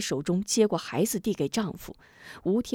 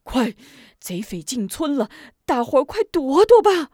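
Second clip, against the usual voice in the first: out of breath; 5 semitones higher, 6 dB louder than usual. Acted. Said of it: "气喘吁吁跑来".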